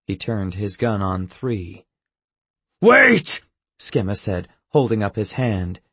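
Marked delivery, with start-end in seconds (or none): 2.8-3.4 high, loud cry; urgent shout. Acted. Skemma stopping the guard from shooting, shouting. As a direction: cry out to stop the shot.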